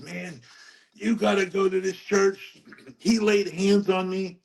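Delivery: high-pitched, wide-eyed retold testimony; imitating awestruck friend. Friend urging him to visit the church, imitated excitement.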